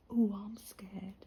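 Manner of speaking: slow whispered mock fright; sarcastic mock fear. Mocking the lad whose mum won't allow the kitten, sarcastic whisper. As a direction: pretend to be scared, dripping sarcasm.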